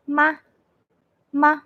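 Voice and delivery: "ma" very short and very light; touch "ma" briefly and let it go; neutral tone. Shown as a demonstration. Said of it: "very short and very light".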